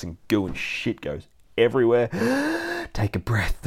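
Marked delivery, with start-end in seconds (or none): 2.1-2.9 big high gasp for air; excited gasp. Real, not acted. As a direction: suck in a breath, over-excited. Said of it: "I'm getting too excited".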